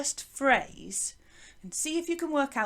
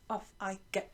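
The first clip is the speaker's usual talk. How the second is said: ordinary delivery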